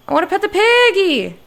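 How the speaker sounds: loud whiny plea to pet the piggy; eager shout. Shown as a demonstration. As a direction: burst out with childlike longing.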